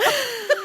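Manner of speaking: quick high burst of laughter. Real, not acted. Cracking up with Mandy, high laugh.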